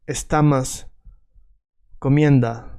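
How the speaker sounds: Russian accent, final o turned to "a"; Russian-accented Spanish. Shown as a demonstration. Teacher imitating Russian learners, Russian vowel reduction. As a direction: say estamas comienda, like a Russian speaker. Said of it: "So Russians often say".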